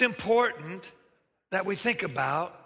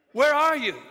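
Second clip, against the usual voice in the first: loud, raised call; God calling out; 6 semitones higher, 6 dB louder than usual. Acted. God calling Adam in the garden, raised call. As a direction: call out high across the garden. God's question repeated.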